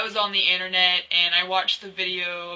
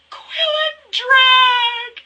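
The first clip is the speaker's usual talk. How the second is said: much higher, much louder, very slow shrieked cry; fangirl shriek; 11 dB louder, much slower than usual. Retold or imitated. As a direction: wail it like a fan overcome with glee. Shouts her reaction again.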